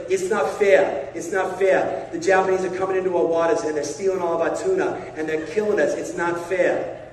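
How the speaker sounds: put-on aggrieved Boston fisherman accent; indignant Boston-accented griping. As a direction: complain in a thick Boston accent, leaning on the vowels. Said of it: "with a Boston accent".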